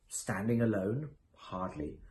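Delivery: normal talking voice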